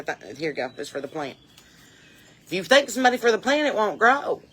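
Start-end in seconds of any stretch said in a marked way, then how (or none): 0.0-1.4 low, soft imitation of Granny; discreet granny. Granny handing a nickel for a plant, low and hushed. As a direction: slip it over on the quiet.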